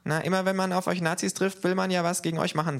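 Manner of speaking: high, cocky kangaroo voice; cheeky kangaroo. A narrator's reading. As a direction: toy with the thugs as the kangaroo. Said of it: "sagt das Känguru". (freches Känguru, hohe Stimme)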